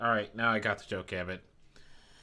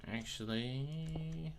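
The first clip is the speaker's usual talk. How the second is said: muttered to self; 3 semitones higher, 10 dB quieter than usual. Real, not acted. Streamer mutters to himself.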